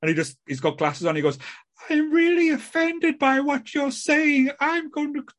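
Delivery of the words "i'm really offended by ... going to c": high, slow, drawn-out mock-offended voice; hammed-up bad actor taking offence; terrible acting. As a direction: drag out the complaint in a high, badly acted voice. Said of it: "it was the worst acting and all".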